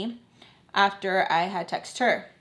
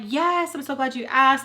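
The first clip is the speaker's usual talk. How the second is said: bright, eager people-pleasing reply; extra nice; 5 semitones higher, 4 dB louder than usual. Retold or imitated. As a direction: sound extra sweet and eager. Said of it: "I'm being extra nice".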